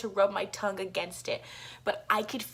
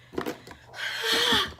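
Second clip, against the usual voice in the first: high, drawn-out gasp; exasperated gasp; 14 semitones higher than usual. Real, not acted. Vlogger losing track of the story, exasperated gasp.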